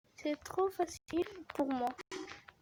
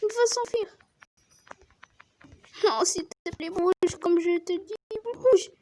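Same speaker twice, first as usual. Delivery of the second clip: loud, much higher frantic pleas; frantic yelling; 5 semitones higher, 7 dB louder than usual. Real, not acted. Girl yelling at her shark to escape, frantic loud pleas. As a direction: yell at the shark to get moving. Begging shark to move away.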